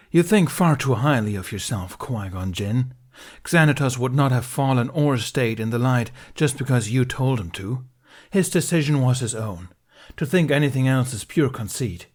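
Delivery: loud, scornful lecture; angry. A narrator's reading. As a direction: tear into him. Ben lectures Qui-Gon angrily.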